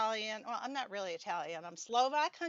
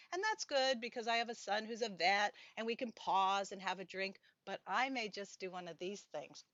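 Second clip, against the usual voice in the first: usual speaking voice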